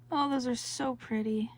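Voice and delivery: normal talking voice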